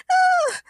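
very high squealed scream; short high shriek of alarm. A narrator's reading. Agnes sees James is hurt, screaming. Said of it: "screamed Agnes Gertrude".

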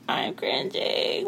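high, drawn-out cringe; acted cringe. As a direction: squeeze it out slowly in mock discomfort. Acts out cringing at slang.

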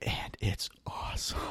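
hushed breathy aside; whispering. Shown as a demonstration. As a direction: whisper it close to the mic.

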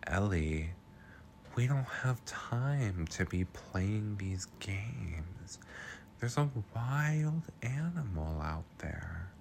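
hushed, slow, whispered warning; weird whisper. Retold or imitated. Recapper imitating Kyle's weird hushed tone. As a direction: whisper it like a spooky secret. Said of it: "in this weird, weird tone".

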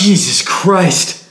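high, drawn-out exasperated curse. Acted. Fed up with his friend's questions, exasperated. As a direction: stretch it out, fed up.

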